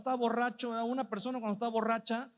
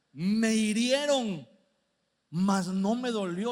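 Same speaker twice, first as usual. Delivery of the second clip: versículo lento y fuerte, cada palabra marcada; lectura enfática; 4 dB louder, slower than usual. Acted. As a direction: proclama la escritura despacio. Lee el versículo del borracho. (el predicador lee el verso del borracho, lento y enfático)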